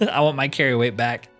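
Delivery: ordinary talk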